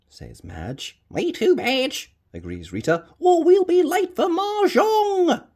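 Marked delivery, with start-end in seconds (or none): 1.1-5.4 granny Rita. Acted. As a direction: play a fussy granny.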